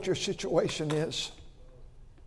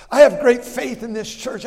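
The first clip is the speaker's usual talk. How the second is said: raised, declaring voice; loud proclamation; 5 semitones higher, 8 dB louder than usual. Real, not acted. Preacher declaring faith to his church, loud.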